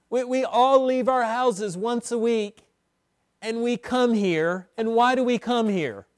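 building preacher's cadence; rising sermon fervor. Acted. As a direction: lift the congregation toward praise.

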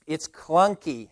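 ordinary talk, nothing marked

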